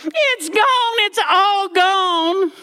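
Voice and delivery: high, slow wailing cry of loss; mock-wail it at the moon; wailing granny. Granny bursting into the room in a high wail.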